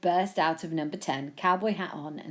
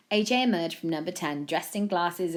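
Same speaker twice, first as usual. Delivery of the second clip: ordinary delivery, nothing marked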